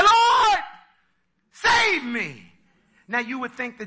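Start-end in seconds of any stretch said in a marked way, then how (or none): 0.0-2.5 crying out. Acted. Peter sinking, calling to the Lord loud and high. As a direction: fling out a desperate call for help. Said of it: "he said".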